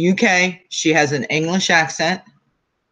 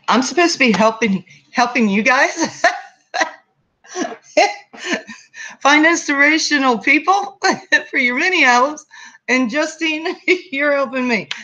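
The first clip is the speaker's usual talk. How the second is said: real laughter breaking through, much higher pitch; laughing while talking; 10 semitones higher than usual. Real, not acted. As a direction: giggle your way through it, voice lifting high. Amused at her own mix-up.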